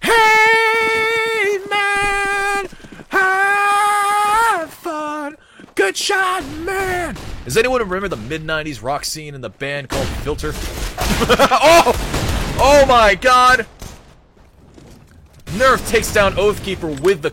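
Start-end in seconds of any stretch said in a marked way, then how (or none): 0.0-7.1 screeching much higher, loud mock praise; falsetto shout. Shrieking mock compliment. 10.6-13.7 high loud astonished shriek; shrieking laugh and gasp. Real, not acted. Shocked shriek at play.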